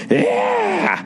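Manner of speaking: mock an overexcited candidate's whoop; overexcited yell. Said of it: "getting too excited and going".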